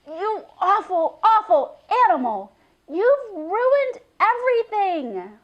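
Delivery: high, loud, furious outburst; furious scolding. A narrator's reading. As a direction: tear into the goat with rage. Girl scolds the goat furiously.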